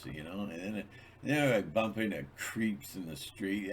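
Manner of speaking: ordinary delivery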